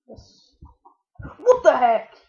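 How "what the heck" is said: loud, startled exclamation; real shocked yell; startled outburst. Real, not acted. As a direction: shout it in sudden disbelief. Startled by attack in game.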